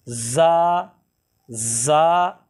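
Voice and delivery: ज़ा said with a sharp hissing whistle; whistling z. Shown as a demonstration. Teacher showing the whistling letter, sharp hiss. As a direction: push a whistle through ज़ा. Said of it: "तेज़ सीटी की आवाज़ आ रही है".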